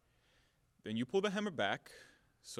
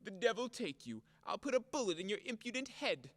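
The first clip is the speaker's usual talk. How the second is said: mocking her; 4 semitones higher, 3 dB quieter than usual. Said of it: "What can a person say".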